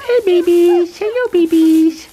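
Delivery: high, loud falsetto greeting; squeaky voice. Real, not acted. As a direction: squeak happily at puppies. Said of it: "my squeaky voice".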